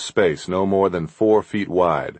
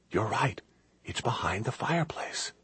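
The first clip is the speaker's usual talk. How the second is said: soft, higher hushed voice; whispered; 3 semitones higher, 11 dB quieter than usual. A narrator's reading. Kate telling Chris he is right, whispering. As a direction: whisper it as a realization, lifting at the end. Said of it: "She whispered".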